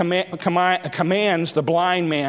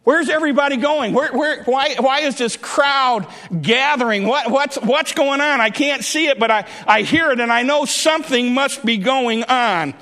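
higher, eager questioning; blind man asking; 5 semitones higher than usual. Acted. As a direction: urgently ask what the commotion is. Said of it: "he asks the question".

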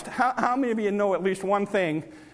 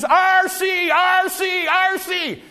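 high, loud yell of a name, three times; calling a friend at full voice across the train yard; holler at the top of lungs; 10 semitones higher, 4 dB louder, slower than usual. Retold or imitated. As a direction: holler it out to a friend far down the track. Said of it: "I'd holler at the top of my lungs".

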